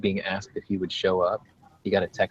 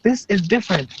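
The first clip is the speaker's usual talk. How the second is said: indignant mock voice; 12 semitones higher, 6 dB louder than usual. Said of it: "She is like".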